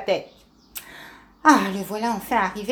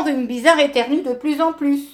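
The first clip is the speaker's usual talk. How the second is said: plain reading voice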